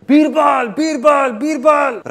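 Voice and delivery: high, loud calling out; screaming a name. Acted. King running to the palace, screaming for Birbal. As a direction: yell the name across the palace. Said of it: "Shouting and screaming".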